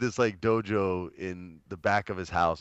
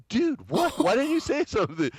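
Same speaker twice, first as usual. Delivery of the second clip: high-pitched retold disbelief; imitating his own stunned reaction, high; astonished. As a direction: squeak it out in mock amazement.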